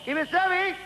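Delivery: high, far-carrying call from the car park; freezing shout. Acted. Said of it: "He shouted".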